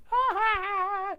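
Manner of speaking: very high, loud jeering ha-has; mock class laughter. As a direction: cackle it like a mocking crowd of kids. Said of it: "the whole class".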